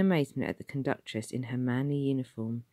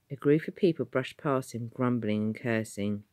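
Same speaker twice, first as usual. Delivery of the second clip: ordinary delivery, nothing marked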